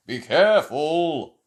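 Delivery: loud, slow warning; mock yell. A narrator's reading. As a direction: call out a warning. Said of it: "Steve yelled".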